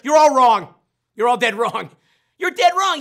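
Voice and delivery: gleefully telling the chat they guessed wrong; playful loud rebuttal. Acted. To his audience, a playful loud you're-wrong.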